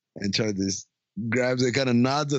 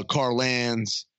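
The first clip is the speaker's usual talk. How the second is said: ordinary talk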